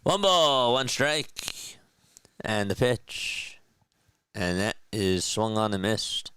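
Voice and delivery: slow, measured ballgame announcer; play-by-play call. Acted. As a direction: call the count like a TV broadcaster. Live play-by-play of his game.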